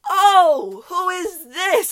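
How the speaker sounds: loud, high, drawn-out cooing; hammed-up high-pitched greeting; gushing neighbor. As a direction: imitate a delighted neighbor, slow and loud. Nosy neighbor greets the child.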